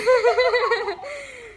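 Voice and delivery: high, bright burst of giggling; high giggle. Real, not acted. Niece giggling high at her shy aunt.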